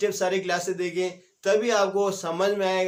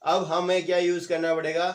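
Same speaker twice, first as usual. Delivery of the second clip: normal talking voice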